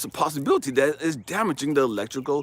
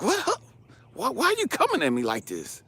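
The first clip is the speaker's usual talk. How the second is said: high, incredulous protest; startled, stammered questioning; taken aback; 9 semitones higher than usual. Retold or imitated. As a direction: recoil in disbelief, pitch jumping up. Taken aback by God's rebuke.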